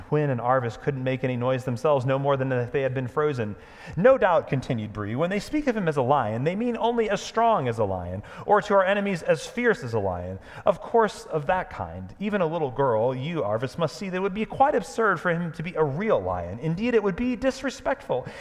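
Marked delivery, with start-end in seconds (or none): 4.0-4.5 lofty, loud and higher, eyes half shut; superior tone. Acted. Proud horse Bree lecturing the girl, superior. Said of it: "in a rather superior tone". 5.2-18.3 higher and louder, lofty lecturing; superior tone. Acted. Proud horse Bree scoffing at Aslan, superior. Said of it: "in a rather superior tone".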